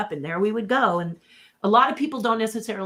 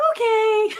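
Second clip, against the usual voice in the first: much higher, laughing meek 'okay'; mock innocent okay; 14 semitones higher, 4 dB louder than usual. [name] answering Brian's warning, high and giggly. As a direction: squeak it out like a guilty kid. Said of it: "And I'm like".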